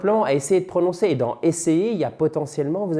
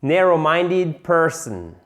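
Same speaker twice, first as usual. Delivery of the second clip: slow, exaggerated English accent on Narrow-minded person; 2 semitones higher, 3 dB louder, slower than usual. Shown as a demonstration. Teacher exaggerating an English accent while modelling. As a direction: overdo the English accent, slowly. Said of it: "cet accent anglais débile".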